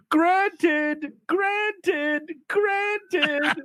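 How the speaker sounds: high, strained villain cries; hammed-up high villain chant. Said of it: "he's just going".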